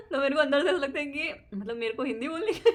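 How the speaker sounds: high, loud laughing through the words; can't stop laughing while telling it. Real, not acted. Telling viewers about mother-in-law's request, laughing hard.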